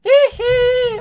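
a high falsetto squeal, very loud; loud falsetto hee hee imitation. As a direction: shriek it like the pop star. Imitates [name]'s signature squeal.

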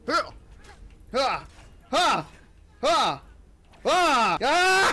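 sharp attack cries building to a yell; battle grunts. Acted. Battle cries while swinging sword.

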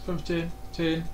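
ordinary talk

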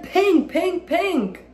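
high, eager chant of pink; a child chanting what she wants; eager little-girl chant. A narrator's reading.

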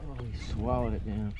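usual speaking voice

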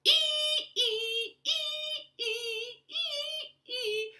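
high sung ee ee; sawing sound. Shown as a demonstration. Storyteller imitates the saw for children, high and sung. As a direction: sing a high squeaky saw. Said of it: "saw the wood".

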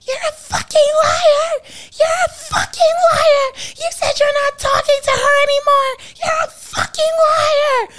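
very high, loud mock screaming; hammed-up shrill yelling; screaming accusation. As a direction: imitate a wife shrieking in a furious argument. Wife heard arguing in background.

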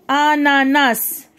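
"Ananas" slowly, each A drawn out; syllable by syllable. Shown as a demonstration. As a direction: stretch "Ananas" into a-na-nas. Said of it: "le son qui". ("Ananas" مقطعًا مقطعًا ببطء)

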